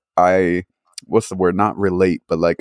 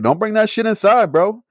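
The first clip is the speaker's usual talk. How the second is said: mock tough warning; 10 semitones higher than usual. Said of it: "I was like".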